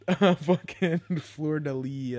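high, loud and slow, laughing through the words. Real, not acted. Vlogger mocking a guy's hat, laughing hard. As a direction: crack up while saying it.

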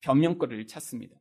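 usual speaking voice

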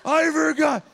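slow, drawn-out high holler; loud oblivious man. Retold or imitated. Homeless man calls out to visitors, hollered imitation. Imitates Donald calling out again.

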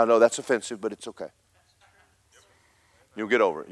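ordinary talk

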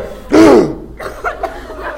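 high, sharp mock gasp; shocked gasp. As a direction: reenact a horrified intake of breath. His shocked reaction, reenacted.

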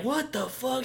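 high, soft imitated shock; hushed disbelief. Viewer seeing her breastfeed wood, hushed disbelief. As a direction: say it under your breath, stunned.

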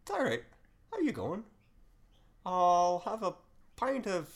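high, bouncy Irish pub voice; Irish accent. Acted. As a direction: play the Irish guy high and cheery. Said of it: "I was meant to do the Irish guy".

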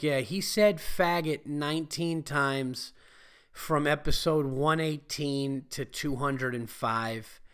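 slow, deliberate reading of charges; hammed-up indictment, drawn out; mock accusation. Imagined report of his old quotes.

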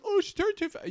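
high mocking fan voice; mocking whiny fan. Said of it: "people who are like".

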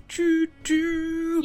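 train whistle. Acted. Game master voicing the train engine, high cartoon hoot.

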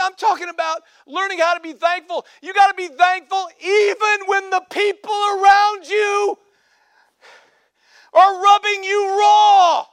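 loud, high, slow preacher's cry; full-force preaching. Acted. Pastor exhorting the church, full preaching shout. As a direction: drive it home from the pulpit.

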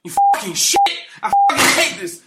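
loud, high-pitched comic meltdown; angry outburst. Acted. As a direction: explode into shouted, over-the-top rage. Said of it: "I'm so angry".

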